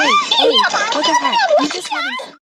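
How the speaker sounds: high, loud shocked cries; high alarmed yell. Acted. Character yelling in alarm.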